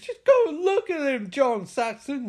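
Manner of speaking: high, loud mock scream at the detective. Nancy screaming at the detective to check Rod, high loud shriek. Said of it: "Nancy screams".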